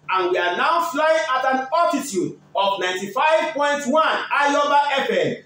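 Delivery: upbeat pilot-style announcement, slowed; radio announcer. Acted. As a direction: hype the flight to Europe like an airline captain. Radio host's airline-style sports link.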